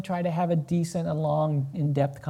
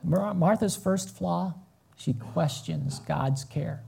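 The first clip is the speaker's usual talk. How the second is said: ordinary delivery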